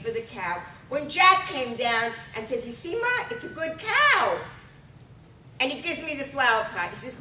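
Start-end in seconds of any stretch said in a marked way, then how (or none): 2.7-4.4 put-on very high kid voice; high, hopeful little-boy appeal; boy pleading with Ma. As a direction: pitch it way up like an eager son. Son reassures mother in story.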